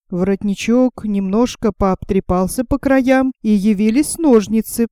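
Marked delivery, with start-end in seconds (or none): none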